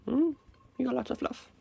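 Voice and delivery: high, soft cooing. Real, not acted. Farmer cooing softly at a fluffy kitten. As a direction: coo over the fluff.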